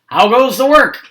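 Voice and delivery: bossy farmer shouting from bed; lazy farmer calling. A narrator's reading. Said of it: "called the farmer".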